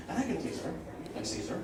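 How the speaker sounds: unvoiced sly whisper. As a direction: hush it to a suggestive whisper. Sudden drop after shout.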